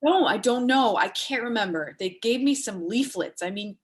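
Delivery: normal talking voice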